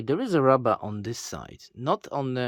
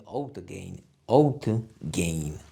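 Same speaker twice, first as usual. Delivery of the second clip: quiet; 9 semitones lower, 5 dB quieter than usual. Shown as a demonstration. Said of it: "Gain test".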